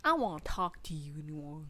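soft, low mock of a parent; lightly voiced parent cutting someone off; imitating their mother. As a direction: quietly mimic a parent giving the silent treatment. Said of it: "They're always like that".

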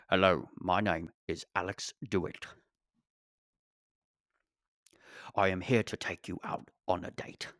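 lightly done Cockney Michael Caine, low and soft; a soft, hinted London accent like Michael Caine. Pretend. Podcaster trying a Michael Caine accent to ask for a date. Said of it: "Maybe speak in his accent".

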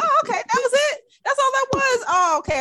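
high, perky mock relief; sudden realization. Talking to herself once period starts, acted relieved surprise. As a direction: brighten up as if it all just cleared.

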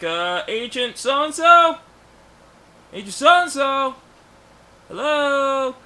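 high, drawn-out questioning call. Acted. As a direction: call into an empty place for someone. Acts out Peter calling out, searching.

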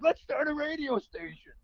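high-pitched, eager proposal. Acted. Dreaming of radio back in sixty-five, eager. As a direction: pitch it high like a big new idea.

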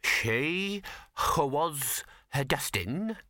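put-on slow, over-refined genteel accent with stray H's; affected genteel accent. A narrator's reading. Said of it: "grip of acute class consciousness".

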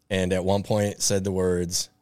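usual speaking voice

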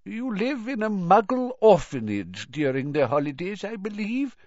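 put-on high, feeble elderly voice, inquiring; curious old headmaster. A narrator's reading. Old headmaster asks the boy about his holiday home, curiously. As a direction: probe gently as an aged wizard. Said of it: "said Dippet curiously".

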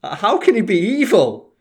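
high, loud mock-innocent question; sarcastic disbelief. Mocking fans who excuse the villain, in a sarcastic high voice. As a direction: play dumb as a fan who can't see evil.

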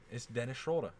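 Schroeder said the German way, Shroel-der; German-style name. Shown as a demonstration. Explaining the German player's name to a friend. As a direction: insist on the German Dennis Schroeder. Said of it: "He's German".

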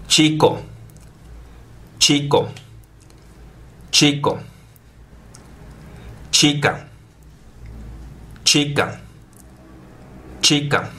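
"Chico" and "Chica" with a hard ch, like a t before the sh; strong Spanish ch. Shown as a demonstration. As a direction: model the strong ch of "Chico" for learners to repeat. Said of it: "o som do C H em espanhol é mais forte".

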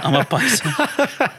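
high, drawn-out joke through laughter; laughing echo. Pretend. Echoing a friend's pun, high and laughing. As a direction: stretch it out, laughing.

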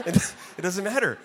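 words breaking through a chuckle; laughing answer. Retold or imitated. Husband answering his wife after the vision, laughing it off.

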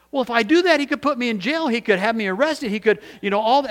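high, agitated objection; alarmed, high-pitched worrying aloud; panicked protest. Retold or imitated. Man protests he could be jailed.

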